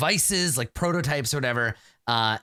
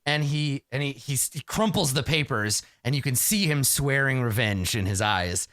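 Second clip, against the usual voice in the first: normal talking voice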